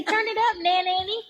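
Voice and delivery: playful high-pitched grandchild voice; imitating cheering grandkids. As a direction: egg grandma on in a high kiddie voice. Voicing her grandkids cheering grandma on.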